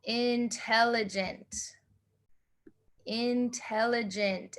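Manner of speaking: Intelligent said slowly and softly, syllable by syllable; teacher sounding out Intelligent slowly; model pronunciation. Shown as a demonstration. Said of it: "So we have intelligent twice".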